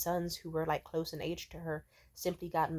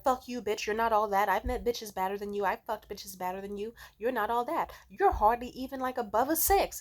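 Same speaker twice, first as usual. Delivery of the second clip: loud, raised-pitch imitated rant; going off angrily; 5 semitones higher, 5 dB louder than usual. Spurned male model insulting her, going off angrily. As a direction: lash back in a heated mock tirade. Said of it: "And he goes off".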